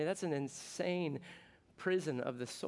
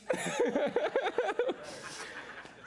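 laughing. Real, not acted. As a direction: crack up laughing. Laughs at his own accent.

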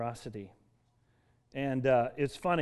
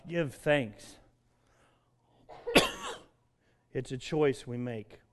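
ordinary talk

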